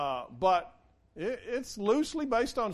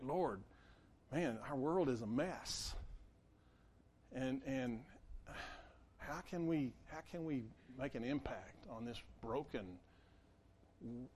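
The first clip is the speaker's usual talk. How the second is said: softer, low-key worried plea to the Lord; overwhelmed prayer; 7 semitones lower, 12 dB quieter than usual. Retold or imitated. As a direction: murmur it like a weary, overwhelmed prayer. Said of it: "sometimes I feel like one of these ten".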